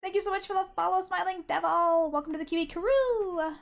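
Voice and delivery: high, fast, cheery thank-you; excited high thanks. Real, not acted. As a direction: rattle it off high and bright. Thanking a new follower.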